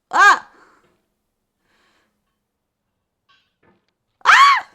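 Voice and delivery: high, loud yelp of panic; real startled shriek; falling scream. Real, not acted. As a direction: scream as the climber drops. Screams as climber falls.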